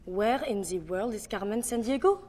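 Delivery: French accent, question lifting at the end. Shown as a demonstration. Accent coach demonstrating a card line, French accent. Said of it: "how to do a French accent".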